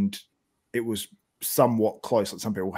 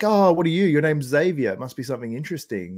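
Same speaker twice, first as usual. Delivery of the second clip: curious people; 5 semitones higher, 4 dB louder than usual. Retold or imitated. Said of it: "people are like".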